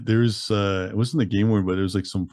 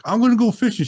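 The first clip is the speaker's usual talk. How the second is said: much higher, louder playful defiance; defiant insistence; 14 semitones higher, 5 dB louder than usual. Retold or imitated. Injured man defying Amanda about going fishing, high playful insistence. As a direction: squeal it like a stubborn kid. Stubbornly defies the rescuer.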